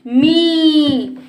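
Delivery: मी slow and loud, ee sustained; held long. Shown as a demonstration. Teacher drills pupils on मा plus matra, held long. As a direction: stretch out the ee in मी strongly. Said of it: "हम देर तक करते हैं".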